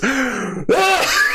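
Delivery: laughing, high imitation of a despairing yell; half-laughing copy of the dad's outburst; mock anguished shout. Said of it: "rant mode of a broken man".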